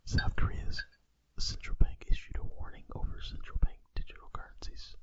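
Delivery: soft whispered news read; ASMR whisper. Pretend. Podcast host reading crypto news as ASMR, whispering. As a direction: read the headlines in a hushed whisper. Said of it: "We just wanted to do Asmer".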